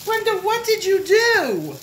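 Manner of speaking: high, loud cry of real disbelief; astonished outcry. Real, not acted. To Brenda about her lavish gift, astonished outcry.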